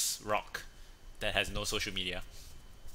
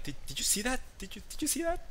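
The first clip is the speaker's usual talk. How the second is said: much higher, panicky voice; frantic wife; 13 semitones higher, faster than usual. Retold or imitated. Wife asks her husband if he saw it, panicky. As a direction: squeak it out in alarm. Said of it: "And she's like".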